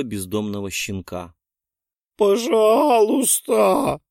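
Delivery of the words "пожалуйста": higher, louder, very slow crying plea; chorus of begging. A narrator's reading. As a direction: wail it together. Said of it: "Дружным хором поддержали".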